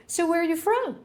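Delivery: high-pitched curious question; lightly voiced questioner.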